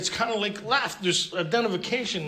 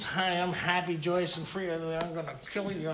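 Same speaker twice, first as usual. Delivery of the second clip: soft, fake-calm recital turning menacing; whisper-calm cheer that hides a threat; mock serene meeting voice; 6 dB quieter than usual.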